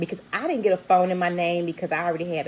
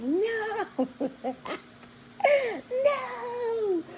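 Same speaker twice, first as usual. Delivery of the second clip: very high, laughing, drawn-out no; laughing disbelief; 4 dB quieter than usual. Retold or imitated. Mom realising her daughter gave her name, squeaky laughing no. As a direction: crack up while voicing her dismay. Mom's horrified reaction, laughing.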